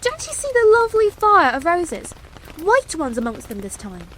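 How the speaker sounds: put-on high, bewildered child's question; bewildered princess. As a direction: ask it high and puzzled. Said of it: "almost as bewildered as he".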